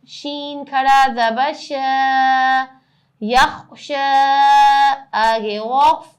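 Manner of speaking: slow sing-song letter-by-letter spelling; qaida spelling drill. Acted. Teacher spelling words aloud for her students in a drill. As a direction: chant each letter and vowel for pupils to copy.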